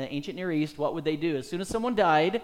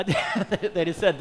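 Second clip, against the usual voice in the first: laughing; 3 dB louder, much slower than usual. Real, not acted. Joking about greedy faith healers.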